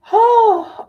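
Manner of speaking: high, loud burst of laughter; laughing exclamation. Real, not acted. Streamer laughing at her own joke to the chat. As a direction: laugh it out, then settle down. Laughing after mock quote.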